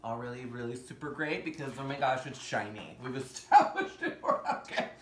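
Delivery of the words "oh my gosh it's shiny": usual speaking voice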